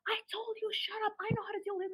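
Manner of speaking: mock exasperation. Boss telling the worker to back off, mock exasperated. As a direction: scold fast and high, held back in volume. Boss losing control, retold.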